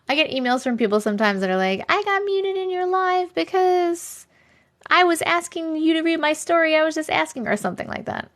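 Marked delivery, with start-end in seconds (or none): 1.9-7.5 high, whiny mimicry of an upset emailer; mock complaint. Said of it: "they get so mad sometimes".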